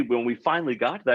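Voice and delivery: normal talking voice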